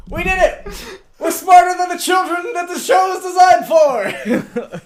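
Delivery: high, squealed victory shout with laughter; gleeful celebration. Real, not acted. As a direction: cheer wildly, high and laughing. Celebrating solving a children's show.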